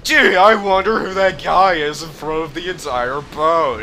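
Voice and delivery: exaggerated fake-innocent puzzlement; feigned cluelessness. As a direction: play dumb, hammed up. Feigns not recognising disguised character.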